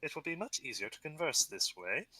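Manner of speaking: a hint of a refined goblin chief; lightly voiced goblin chief. Goblin chief pleased the hero speaks Goblin, lightly voiced. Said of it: "he's like".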